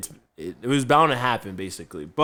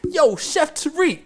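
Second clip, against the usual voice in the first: very high, slow mocking call; imitating Kevin teasing; 5 dB louder, slower than usual. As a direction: drag it out to tease him at work. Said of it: "now he's like".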